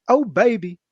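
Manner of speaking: high, loud imitation of an excited whoop; imitated catchphrase. Host imitating Heath after signing his contract, loud excited catchphrase. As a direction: let off a big mock-excited exclamation. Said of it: "he lets off a nice".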